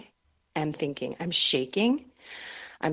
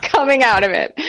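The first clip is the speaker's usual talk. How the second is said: laughing; 6 dB louder than usual. Real, not acted. Telling friends about crying in confession, laughing. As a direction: laugh at the snotty mess. Laughing at her own mess.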